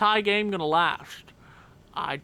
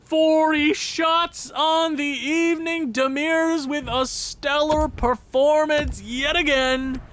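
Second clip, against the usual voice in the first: high, slow broadcaster call; mock announcer; 9 semitones higher, 4 dB louder, slower than usual. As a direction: wrap it up like a TV announcer. Announcer-style wrap-up.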